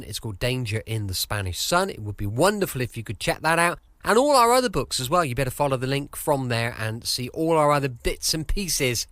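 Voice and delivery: usual speaking voice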